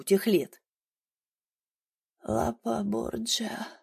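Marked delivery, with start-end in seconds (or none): 2.2-3.7 put-on slow faint whisper of a dying old woman; old woman's whisper. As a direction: breathe it out, barely voiced and slow. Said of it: "прошептала старуха внезапно". (очень старый слабый шёпот)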